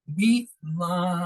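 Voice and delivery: بیما with its vowel held for two counts; stretched madd. Shown as a demonstration. Teacher demonstrating the short madd to students. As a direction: draw out the alif in بیما slowly. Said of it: "دو حرف کے برابر".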